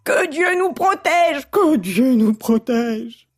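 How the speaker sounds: slow, drawn-out frog plea; frightened frogs crying out; agitated cry. A narrator's reading. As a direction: wail a plea for protection. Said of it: "se mirent à crier".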